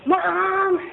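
scolding mom. Retold or imitated. Speaker scolding her mom.

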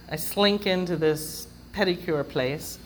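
ordinary talk

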